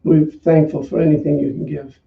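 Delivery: normal talking voice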